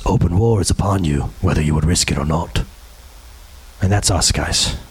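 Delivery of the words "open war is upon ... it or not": much lower, grave king's warning; low, grim movie-hero line; Aragorn's voice. Retold or imitated. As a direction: drop deep and solemn like Aragorn. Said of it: "And Aragorn says".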